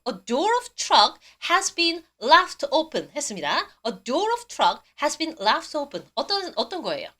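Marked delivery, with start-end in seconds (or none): 0.1-3.1 very slow, careful English; slow model reading. Shown as a demonstration. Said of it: "발음하고 계세요?". 3.9-6.1 very slow, deliberate English; slow repeat reading. Shown as a demonstration. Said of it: "발음하고 계세요?".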